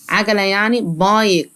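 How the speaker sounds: ordinary delivery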